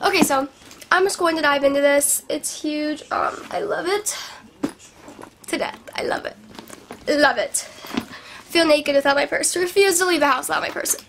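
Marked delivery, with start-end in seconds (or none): none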